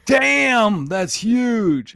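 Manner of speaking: high, loud mock kid amazement; kid's amazed cuss. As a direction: play the wide-eyed kid blurting damn. Kid reacts to huge spider.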